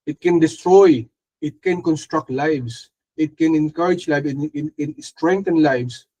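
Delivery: drawn-out preaching, each phrase weighed; slow emphasis. Real, not acted. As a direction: slowly stress each thing the tongue can do. Slow emphatic sermon list. (mabagal at mariing pangangaral)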